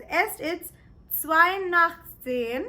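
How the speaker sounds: careful, slow German; "drei vor zwölf" said slowly and clearly; slow German demo. Shown as a demonstration. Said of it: "You can say it as".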